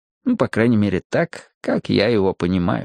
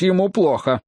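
higher, mildly alarmed call; understated alarmed cry; 6 semitones higher than usual. A narrator's reading. Хопкинс cries that the man is unwell, mild shout. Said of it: "закричал Хопкинс".

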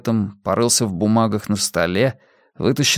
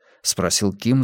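ordinary delivery

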